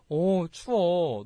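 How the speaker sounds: slow, drawn-out friendly shiver of words; considerate, lingering delivery; speaking nicely. Shown as a demonstration. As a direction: stretch it out kindly and sympathetically. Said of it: "되게 말을 이쁘게 잘하니까".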